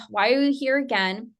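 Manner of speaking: ordinary talk, nothing marked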